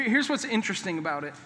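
usual speaking voice

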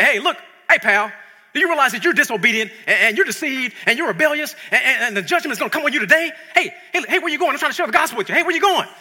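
loud, high, pushy street-corner preaching; pushy street preacher. Retold or imitated. A preacher yelling at a passerby who walks away. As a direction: chase them down the street yelling. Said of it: "that's not the way you do it".

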